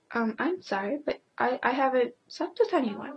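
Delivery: a hint of a prim defensive answer; polite retort. Vlogger replies to the rude kid, soft prim retort. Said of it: "And you're like".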